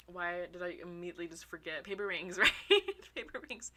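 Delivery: usual speaking voice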